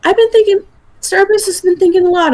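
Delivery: lightly voiced high-pitched character. Player voices character Starburst.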